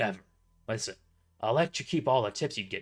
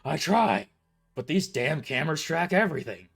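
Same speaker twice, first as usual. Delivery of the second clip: complaining boss; 4 semitones higher, 8 dB louder than usual. A narrator's reading.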